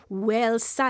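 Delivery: usual speaking voice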